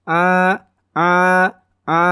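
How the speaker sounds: constrict the throat on each A; clear ain. Shown as a demonstration. Teacher drills learners, clear ain A. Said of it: "Ainnya harus jelas".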